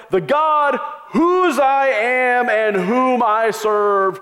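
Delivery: slow, high, grand and loud; lofty biblical phrasing. Shown as a demonstration. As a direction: ham up the old-fashioned holy phrasing. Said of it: "Do you ever talk like that?".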